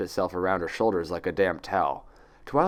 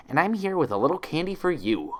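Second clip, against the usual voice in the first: much higher, sugary nurse voice; cheerful caregiver offering candy; chirpy nurse; 8 semitones higher than usual. Acted.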